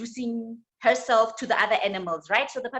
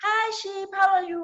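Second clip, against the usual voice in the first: timid puppy; 9 semitones higher than usual. Acted. Timid puppy greets sheep.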